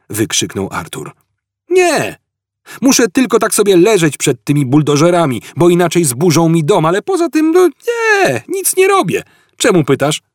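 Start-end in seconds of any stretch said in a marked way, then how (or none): 1.7-10.2 high, heavy mock-cheerful sarcasm; sarcastic. A narrator's reading. Arthur mocks Fort's question from under bulldozers, sarcastic. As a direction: Arthur drips sarcasm from the mud, pitch soaring. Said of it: "Sarkazm jest na Betelgeuse nieznany".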